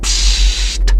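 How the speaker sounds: voiceless sharp "psst"; hissed shush. A narrator's reading. Man silencing the boy in his ear, hissed. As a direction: hiss a hushing sound. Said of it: "zasyčel mu do ucha".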